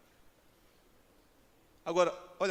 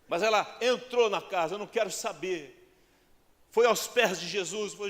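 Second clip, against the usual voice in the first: high, loud, driving pulpit delivery; impassioned preaching; 8 semitones higher, 8 dB louder than usual. Acted. Preacher challenging the congregation about crying out, impassioned preaching. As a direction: preach at full force, voice raised.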